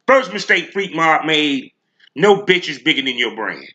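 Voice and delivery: ordinary talk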